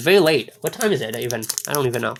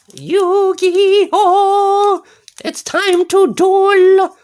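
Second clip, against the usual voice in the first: much higher, slowed-down duel cry; 12 semitones higher, 6 dB louder, much slower than usual. Pretend. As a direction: squeak it out high and drawn-out like a hype call. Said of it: "Why am I speaking such a high voice?".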